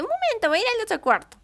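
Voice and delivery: high, quick voiced young bride; lightly voiced young wife stepping away; Elizabeth's voice.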